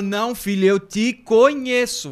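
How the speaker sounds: a stern mom who knows her son; mother scolding. Retold or imitated. Mother scolding in retold story.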